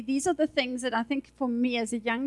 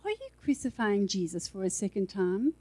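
softer, sly quiet question; soft insinuating; 5 semitones lower, 7 dB quieter than usual. Shown as a demonstration. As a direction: slip it in gently and slyly. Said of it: "He'll say".

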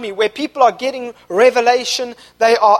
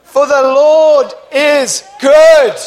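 louder, slower, shouting it out with conviction; believing shout; 3 semitones higher, 8 dB louder, much slower than usual. Shown as a demonstration. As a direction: proclaim it like you mean every word. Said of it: "Say it like you believe it".

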